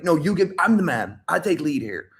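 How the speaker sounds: acted insecure tough guy; mock alpha posturing. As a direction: insist on being in charge, a bit desperately. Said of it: "obsessed with alpha, obsessed with frame".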